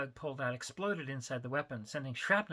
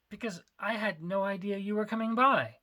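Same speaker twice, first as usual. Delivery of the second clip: indignant; 6 semitones higher, 7 dB louder than usual. Acted. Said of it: "frowned indignantly".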